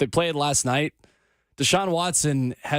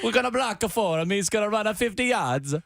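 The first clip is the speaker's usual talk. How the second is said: put-on Italian accent; 6 semitones higher than usual. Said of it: "backed my way into one of my better accents".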